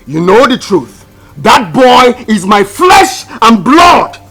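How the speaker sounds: high, very loud, slowing for emphasis; impassioned shout. Acted. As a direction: bellow your claim as the father. Father shouts his claim to boy.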